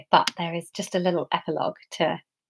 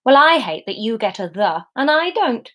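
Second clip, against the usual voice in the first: ordinary delivery, nothing marked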